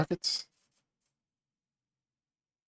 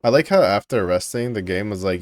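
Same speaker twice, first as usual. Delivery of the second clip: ordinary talk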